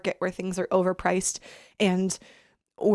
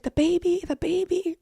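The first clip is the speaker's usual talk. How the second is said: mom asking weakly; 8 semitones higher than usual. Retold or imitated. Mom asking about baby.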